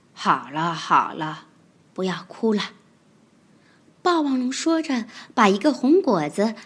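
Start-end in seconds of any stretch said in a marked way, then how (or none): 0.1-2.7 低低的、轻轻的哄孩子; 像爸爸哄哭泣的孩子; 温柔安慰. Acted. 霸王龙安慰哭泣宝宝. (霸王龙低声温柔地安慰)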